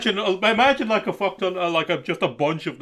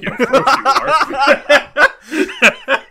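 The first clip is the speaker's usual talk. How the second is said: drawn-out mock "of course you are"; sarcastic; 10 semitones higher, 6 dB louder, slower than usual. Rolling eyes at an anime shower scene, slow sarcastic drawl. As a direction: drag it out with heavy sarcasm.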